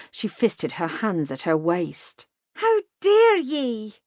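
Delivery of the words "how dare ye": much higher, louder, slow furious cry; indignant outburst. A narrator's reading. Katie rounds on the rude rider, high furious cry. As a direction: explode with offended fury. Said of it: "changed from fear to anger".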